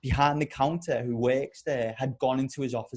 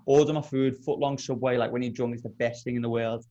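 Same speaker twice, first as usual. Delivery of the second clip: ordinary talk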